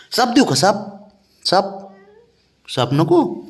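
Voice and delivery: high playful offering voice; coaxing offer. Real, not acted. Father tempting sulking pet with a ball, high coaxing. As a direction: tempt them with a toy, voice lifted high.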